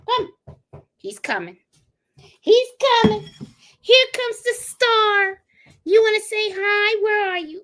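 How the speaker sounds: high, doting pet voice. Real, not acted. Baby talk to her dog.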